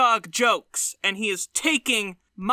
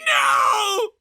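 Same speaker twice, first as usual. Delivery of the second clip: high, loud, emphatic no; shouted no; 10 semitones higher, 4 dB louder than usual. Retold or imitated. Fans yelling back at the comedian, high shouted no. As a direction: yell it back at him.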